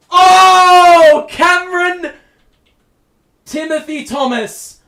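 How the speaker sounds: high, loud burst then full name; real roaring excitement; ecstatic yell. Real, not acted. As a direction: yell the player's name in delight. Big play by Cam.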